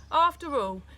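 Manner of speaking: plain reading voice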